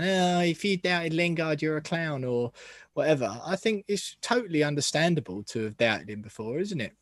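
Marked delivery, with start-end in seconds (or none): 0.0-2.2 slow, drawn-out mock jeer; mocking critics. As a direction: imitate scoffing fans, pitched up at the start. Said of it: "all these people coming out now going".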